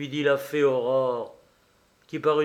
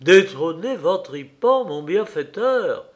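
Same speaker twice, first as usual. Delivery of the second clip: loud, higher outcry, swooping pitch; shocked exclamation; 4 semitones higher, 6 dB louder than usual. A narrator's reading. Pierrot aghast at betraying his benefactor, shocked exclamation. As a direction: cry it out as if aghast. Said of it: "s'écria Pierrot".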